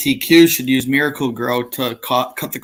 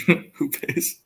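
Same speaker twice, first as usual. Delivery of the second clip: whispered laugh; 5 dB quieter than usual. Real, not acted. Laughing repeat of punchline.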